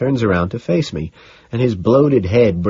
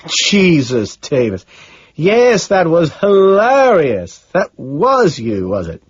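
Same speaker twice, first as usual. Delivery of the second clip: higher, louder, drawn-out amused exclamations; amused disbelief; 9 semitones higher, 5 dB louder, much slower than usual. A narrator's reading. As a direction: savor the hilarity, stretching the key words. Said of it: "finally laughs".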